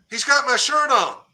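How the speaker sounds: high retold holler to others. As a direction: call it out excitedly across a distance. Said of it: "I holler to the others".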